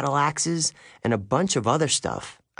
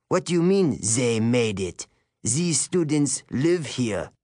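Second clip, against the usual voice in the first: put-on French accent. A narrator's reading. Teacher challenges Grover in a French accent, suspicious. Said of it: "He had an accent. French, maybe".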